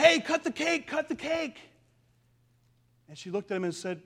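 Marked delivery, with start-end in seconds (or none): 0.0-1.6 excited urging. Retold or imitated. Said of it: "they all said to her".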